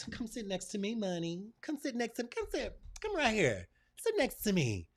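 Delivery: high coaxing call to money. Pretend. Speaker inviting money to sit beside her, high and coaxing. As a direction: coax money over like a pet. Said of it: "I'll have a conversation with it".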